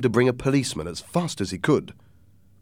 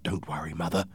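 soft, low, hushed words; calm her down in a lowered voice; hushed and low, keeping it between two; quiet reassurance; 3 semitones lower, 7 dB quieter, faster than usual. Acted. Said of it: "I said quietly".

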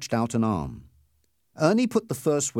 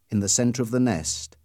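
ordinary delivery

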